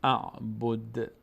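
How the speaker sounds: اعبد ending in a bounced "d-e"; vibrating dal. Shown as a demonstration. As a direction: slowly release the final d of اعبد with a small echo.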